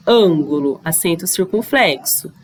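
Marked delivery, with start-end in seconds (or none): 0.1-0.8 "Ângulo" with a closed, nasal A, pitched low; closed vowel. Shown as a demonstration. Teacher showing pupils the difference, closed A in "Ângulo". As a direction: stress the first syllable of "Ângulo", keeping the vowel closed. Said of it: "olha a diferença".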